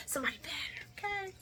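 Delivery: breathy whispered aside; whispered sassy advice. Real, not acted. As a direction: whisper it to the audience.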